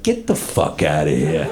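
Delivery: low-pitched Brooklyn Italian dismissal; disbelieving Italian guy. Acted. Italian reacts to news he doubts, low disbelieving dismissal. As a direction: wave it off low, in mock disbelief. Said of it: "an Italian doesn't believe".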